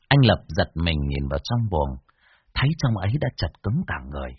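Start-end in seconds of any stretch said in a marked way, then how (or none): none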